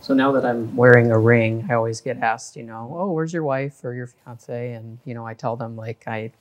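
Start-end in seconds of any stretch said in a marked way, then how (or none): none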